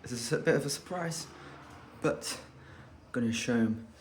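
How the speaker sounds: ordinary delivery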